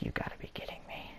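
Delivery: very soft, hushed aside; near-whispered incredulous thought; muttered disbelief. Retold or imitated. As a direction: say it under your breath, quickly. Inner reaction, thought not said.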